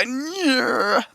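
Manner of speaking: put-on noise. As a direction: imitate an over-the-top put-on sound. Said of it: "there's a level at which you go".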